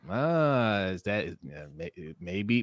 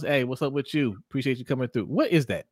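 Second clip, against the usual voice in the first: ordinary talk, nothing marked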